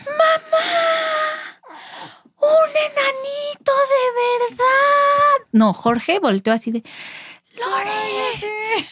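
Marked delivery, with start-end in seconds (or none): 0.0-5.5 put-on high, thrilled child crying out; little girl shouting to her mother in wonder; mock shout, much higher, stretched out slowly; excited child shout. Child shouts seeing dwarf. 7.6-8.9 hushed warning call. Retold or imitated. Father hushes daughter.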